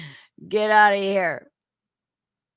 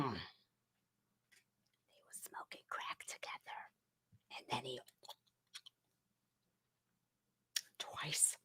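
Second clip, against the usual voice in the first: very soft whispered gossip; mock-confidential whisper, with pauses; hushed scandal whisper; 8 semitones lower, 23 dB quieter than usual. Mock-scandalous gossip about a public figure.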